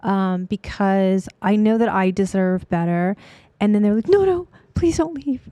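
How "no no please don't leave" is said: mock pleading clients. Clients begging her to stay.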